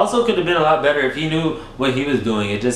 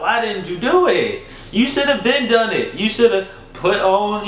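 high, sassy, fed-up rant; lecturing her clueless partner on foreplay; exasperated scolding; 6 semitones higher than usual. Acted. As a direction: tell him off in a much higher voice. Scolding partner in retold scene.

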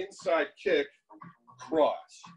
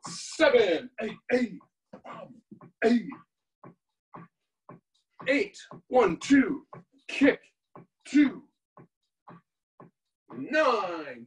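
counting workout reps; 3 semitones higher, much slower than usual. Shown as a demonstration. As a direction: call out the combos over music. Calls combos in class.

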